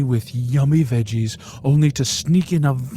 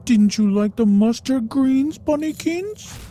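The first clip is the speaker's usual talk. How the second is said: much higher, louder cooing question; baby talk; 13 semitones higher, 4 dB louder than usual. Retold or imitated. Owner asking her bunny about the greens, cooing. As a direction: coo at the pet.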